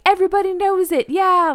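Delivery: high, fast, hammed-up singalong cheer; mock rallying anthem. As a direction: sell it like a stadium anthem everyone knows. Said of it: "that rallying".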